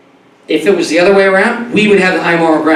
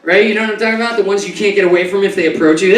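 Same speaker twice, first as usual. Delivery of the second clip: normal talking voice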